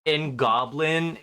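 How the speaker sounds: ordinary talk, nothing marked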